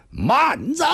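high, commanding halt call; sudden shout. Acted. Spearman halting the thin man, high commanding shout. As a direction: cry out sharply to stop him. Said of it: "突然大喝一声".

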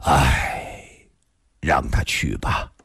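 low, resigned man's voice; sigh, then give in at a low pitch; long weary sigh. A narrator's reading. Li relenting to let him go, resigned sigh. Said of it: "李寻欢突然长长叹了口气".

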